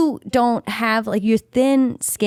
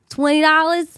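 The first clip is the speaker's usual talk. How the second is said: a quick Bronx "Twenty dollars?", pitched up; Bronx accent; 7 semitones higher than usual. Shown as a demonstration. New Yorker showing her Bronx accent, Bronx "Twenty dollars?". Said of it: "talking about a Bronx sound".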